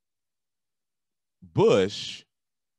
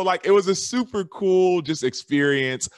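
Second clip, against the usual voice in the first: ordinary talk, nothing marked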